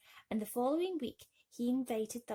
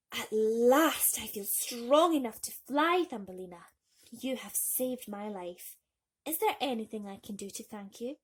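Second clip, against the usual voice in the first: grateful swallow; 2 semitones higher, 7 dB louder than usual. A narrator's reading. Swallow strong again.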